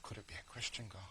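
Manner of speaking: soft, tentative inner question; hushed wondering. Retold or imitated. Man in ambulance wonders about his mother's God, hushed wondering. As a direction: think it aloud very softly.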